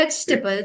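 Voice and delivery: "vegetable" as VEJ-tuh-bul; silent E. Shown as a demonstration. Teacher explains the silent E, reduced pronunciation. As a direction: drop the middle E in "vegetable". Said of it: "The E is silent".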